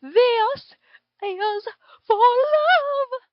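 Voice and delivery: loud, high yell with a slow, drawn-out finish; screamed battle cry. A narrator's reading. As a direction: roar it out for love. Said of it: "then screamed".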